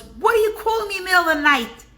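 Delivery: grumpy neighbor woken at night; a rapid, irritated neighbor voice; annoyed neighbor. Retold or imitated.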